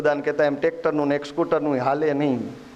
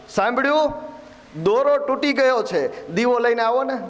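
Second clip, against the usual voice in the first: raised, higher-pitched call to the wife indoors; 6 semitones higher than usual. Retold or imitated. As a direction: call into the house as Kabir, mildly raised. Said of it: "એણે અંદર બૂમ પાડી". (અંદર બૂમ પાડીને બોલાવતાં)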